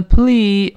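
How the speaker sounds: "please" with a long drawn-out ee; long vowel. Shown as a demonstration. Teacher shows learners the long vowel in please, drawn out. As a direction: stretch the ee in "please", slowly. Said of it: "发长音一".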